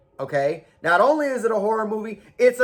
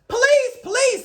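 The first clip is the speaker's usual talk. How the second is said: mock cry for police; 3 dB louder than usual. Calling out for police.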